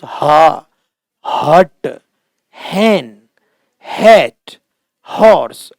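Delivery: हट, हैन, हैट, हॉर्स each with a breathed H; H sounded. Shown as a demonstration. Teacher drilling normal H words, H sounded in हॉर्स. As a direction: punch out हैट, हॉर्स one by one, H audible. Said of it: "एच का उच्चारण है".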